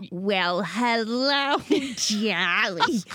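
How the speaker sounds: drawn-out diva catchphrase with a laugh; hammed-up celebrity warm-up line; Carol Channing. Shown as a demonstration. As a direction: stretch the greeting like a stagey star. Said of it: "I have to go".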